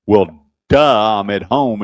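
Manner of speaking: mock "duh" attitude, leaning loud on duh; retold sassy comeback. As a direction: imitate someone stating the painfully obvious. Retold defendant sassing the judge.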